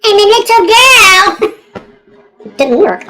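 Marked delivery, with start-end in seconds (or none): 0.0-1.4 high, drawn-out comic wail; mock little girl. Mocking own feeble throw, high drawn-out little-girl cry. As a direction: push it up high and slow, hamming it up.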